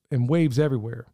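ordinary talk, nothing marked

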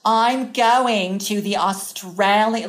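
imitation of a super nasal American accent. Imitating a friend's impression of oneself, nasal American accent. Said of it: "nasal American one".

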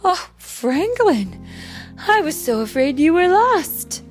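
relieved mother. Acted.

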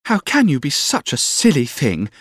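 put-on high, girlish scolding; childish sweetheart. A narrator's reading. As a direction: chide him like a petulant child. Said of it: "Her childish way".

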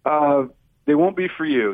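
normal talking voice

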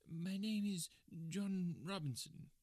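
slow, high, evasive answer; high-pitched, uneasy little-man voice; hesitant little man. Acted. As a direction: give a false name haltingly. Nervous man gives false name.

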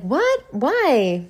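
imitating her roommate's disbelief, very high; mock surprise. Roommate baffled by her refusal, a high mock "what?". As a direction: shoot way up on "What?". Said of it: "She was like".

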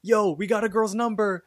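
high, excited cartoon cheer; Rigby. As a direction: crow it high, imitating an excited buddy. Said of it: "gets up and he gasps".

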